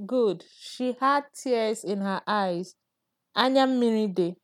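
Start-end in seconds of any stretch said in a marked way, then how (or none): 0.6-2.8 slow and clear. Shown as a demonstration. Teacher repeating the model phrase.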